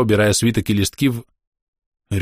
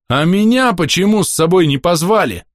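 loud roar, higher; 8 semitones higher, 4 dB louder than usual. Acted. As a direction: bellow it out. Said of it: "взревел я".

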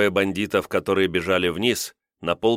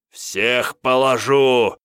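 loud, drawn-out furious roar; enraged yelling; 4 semitones higher, 5 dB louder, much slower than usual. A narrator's reading. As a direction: bellow it slowly, higher, in a rage. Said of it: "орал разъярённый Косьма". (разъярённый протяжный ор)

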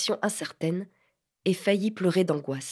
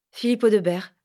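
hasty; 2 semitones higher, 4 dB louder than usual. Acted. Nervous boy hurriedly gives his name to the lady. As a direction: blurt the name out in a rush. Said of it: "dit Phil précipitamment".

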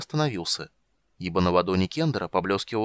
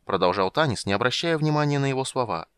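ordinary delivery

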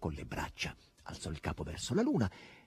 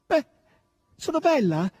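high, loud shout from a heroine; hoarse loud cry; 9 dB louder than usual. A narrator's reading. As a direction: cry it out loud, pitched right up. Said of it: "gridò forte con voce arrochita".

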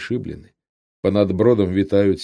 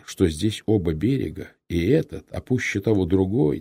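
usual speaking voice

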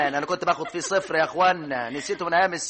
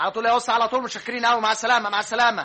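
sending the taxi off; 8 semitones higher, 6 dB louder, much faster than usual. Acted. Father sends taxi away.

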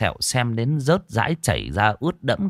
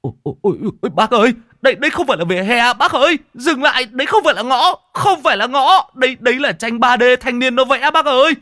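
high, loud, fast shrieking panic; panicked scream; 7 dB louder than usual. Acted. Young driver begging the old man to brake, high panicked scream. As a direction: scream at him to stop the car. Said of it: "Thằng cu tái mặt".

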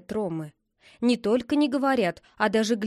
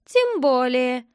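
plain reading voice

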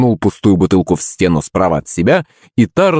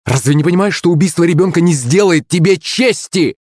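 high, heated reproach; indignant, raised-pitch outburst; flaring up; 9 semitones higher than usual. A narrator's reading. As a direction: flare up at him with fresh force. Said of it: "Вновь не выдержала княгиня, вспыхивая с новой силой".

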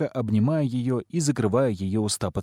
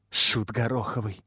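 hissed insult. A narrator's reading. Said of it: "шипит Огонёк".

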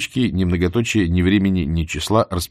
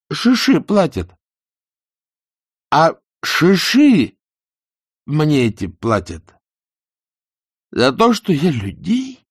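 put-on drunken rant; 9 semitones higher, 4 dB louder than usual. A narrator's reading. Drunk prosecutor rants to wife.

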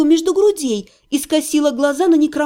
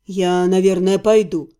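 wistful; 7 semitones lower than usual. A narrator's reading. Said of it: "тоскливо сказал Ирга".

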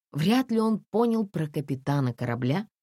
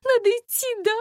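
very high, weepy plea; high-pitched, desperate whimpering woman; tearful pleading; 3 dB louder than usual. A narrator's reading. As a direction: plead through acted tears. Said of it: "расплакалась Настя".

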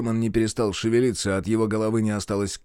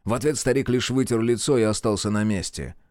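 ordinary delivery

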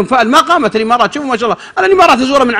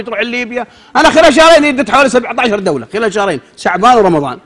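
ordinary delivery

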